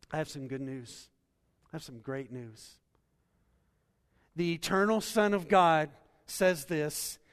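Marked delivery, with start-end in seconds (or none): 4.4-7.2 louder, higher pulpit voice, driving hard; preaching at full force. Acted. Pastor proclaiming Jesus' promise to the congregation, full-force preaching. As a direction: preach it out to the back pew.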